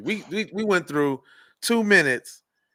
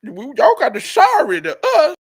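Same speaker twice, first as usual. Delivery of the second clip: higher, louder hammed-up demand; hammed-up protest shout; mock demanding shout; 8 semitones higher, 8 dB louder than usual.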